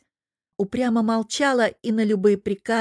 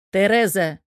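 stern warning call of her name; scolding shout; 6 dB louder than usual. Acted. Devil scolds the girl.

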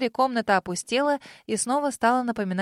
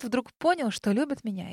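ordinary delivery, nothing marked

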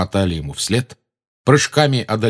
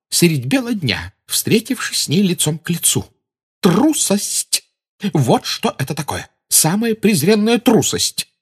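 higher, fierce outraged matron; fierce indignation; 7 semitones higher than usual. A narrator's reading. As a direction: spit out the word cowardice. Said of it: "гримасой свирепого одобрения".

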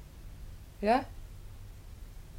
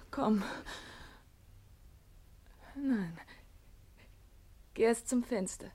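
ordinary delivery

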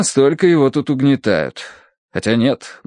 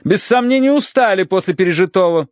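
haughty matron, disapproving; 10 semitones higher than usual. Acted. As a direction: forbid it with icy emphasis. Mrs. Mering's figure in narrated story. (властная дама, высоким голосом)

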